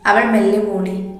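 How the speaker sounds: usual speaking voice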